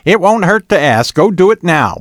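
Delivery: high, loud urging; insistent. A narrator's reading. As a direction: insist brightly that it happen at once. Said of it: "Bonnie insisted".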